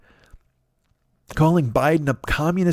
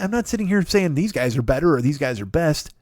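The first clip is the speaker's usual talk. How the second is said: normal talking voice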